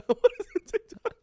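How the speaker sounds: high, fast giggly question; laughing. Retold or imitated. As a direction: squeal it while laughing. Laughing echo of the quote.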